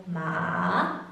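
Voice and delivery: "ma" dipping, then rising; third tone. Shown as a demonstration. Demonstrating the third tone to learners, "ma" falling then rising. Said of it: "both drops and then rises".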